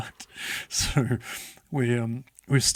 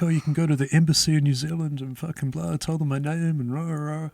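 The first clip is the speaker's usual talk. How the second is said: imitated pushy lady, higher voice running into blah-blah; demanding old lady; 5 semitones higher, 3 dB louder than usual. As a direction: mimic her insistent rant at a raised pitch. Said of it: "coming across very hard to understand and very demanding".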